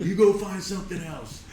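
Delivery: high-pitched mock scolding; retold angry defiance. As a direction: act out ordering a shark away. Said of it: "I was so angry".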